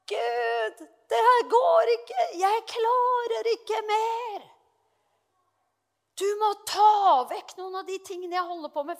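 high, slow, strained plea; desperate prayer. Retold or imitated. Anne begging God to take tasks away, strained and high. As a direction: cry out to God, overwhelmed.

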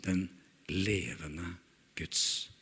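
soft, slow, near-whispered confession; reverent hush. Acted. Peter confessing to Jesus, soft and slow. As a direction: confess it in hushed awe. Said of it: "Da svarte Simon Peter og sa".

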